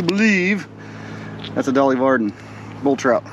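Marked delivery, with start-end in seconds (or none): none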